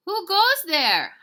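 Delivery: high, very loud drawn-out challenge; hollering. Acted. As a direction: call out like a sentry across space. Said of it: "hollered Scapito".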